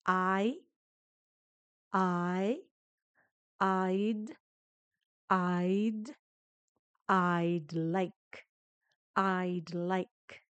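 "I" alone, then "I'd", then "I'd like", the sounds added one at a time; sounds built up. Shown as a demonstration. Teacher breaks the phrase into sounds for learners, slow and clear. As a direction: build it up piece by piece: "I", "I'd", "I'd like". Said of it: "deshacer un poquitito estas, estos sonidos".

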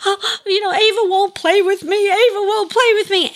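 high, whiny imitation of a crying little girl; mock tearful child. Little girl running to her dad about a playmate, mock tearful. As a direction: wail it like an upset preschooler. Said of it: "the tears pouring down her face".

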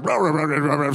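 imitated angry growling gibberish; loud mock-angry babble; angry deep-seated voice. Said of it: "this angry, deep-seated voice".

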